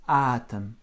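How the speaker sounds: "атом" with a strong first A and a barely voiced O; unstressed O reduced. Shown as a demonstration. Teacher demonstrating the very weak O, "атом" stressed on the first syllable. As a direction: punch the А of "атом", swallow the о. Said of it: "très très atone en fait".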